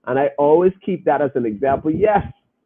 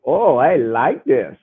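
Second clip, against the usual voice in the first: ordinary talk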